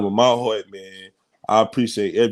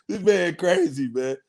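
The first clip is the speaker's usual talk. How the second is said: laughing; 10 semitones higher than usual. Real, not acted. Cohost teases him about Emmy.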